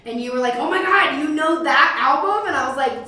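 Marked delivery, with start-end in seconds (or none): 0.6-2.5 excited. Retold or imitated. Friend's excited reaction quoted.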